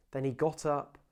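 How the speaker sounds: measured, dramatic Bible reading; scripture reading. Acted. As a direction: read the passage like a story to the church. Reads the scripture aloud.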